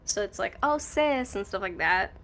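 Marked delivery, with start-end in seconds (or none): 0.6-1.4 high, sassy "Oh, sis"; sassy friend voice. Retold or imitated.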